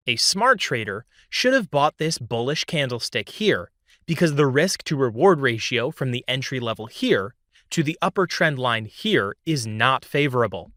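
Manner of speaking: normal talking voice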